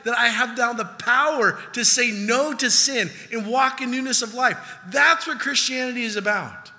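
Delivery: loud, high, driving sermon voice; fiery preaching. Acted. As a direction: preach it hard to the crowd. Preaching at full force.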